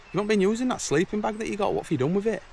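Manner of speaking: quizzing son. Retold or imitated. Questioning son about missing bag.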